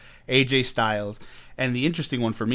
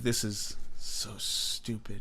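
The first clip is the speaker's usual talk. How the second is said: slow, softer, scornful drawl; mock disdain; 6 dB quieter, slower than usual. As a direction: sneer it with a laugh. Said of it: "The fanboy in me was like".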